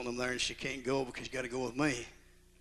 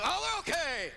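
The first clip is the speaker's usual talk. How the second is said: high, clipped one-word answer; curt mother-in-law; 4 dB louder than usual. Retold or imitated. Negative mother-in-law answering the phone, short and high. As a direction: snap back grumpily. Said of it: "I say".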